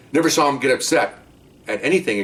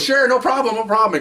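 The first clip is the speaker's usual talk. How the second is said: much higher breezy mock agreement; eager suspect; 12 semitones higher than usual. Suspect cheerfully agreeing to find women, higher breezy. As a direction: toss it off like a happy hustler.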